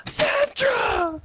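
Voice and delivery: high, loud mock-horror shriek; terrified scream. Friend finding out the zombie is a coworker, screaming. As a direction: scream the name in acted terror.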